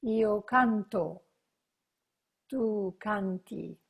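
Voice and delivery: slow, clear, spaced out for students to repeat; slow model conjugation. Shown as a demonstration. Teacher modelling the conjugation slowly for repetition. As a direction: spell out each form slowly for the class. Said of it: "You want to practice with me".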